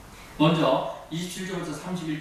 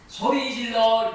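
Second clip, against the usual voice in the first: higher, louder, slow shout; a slow, raised cry of 소리 질러; 8 semitones higher, 5 dB louder, much slower than usual. Shown as a demonstration. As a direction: call it out loud and drawn out. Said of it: "느낌 어때요?".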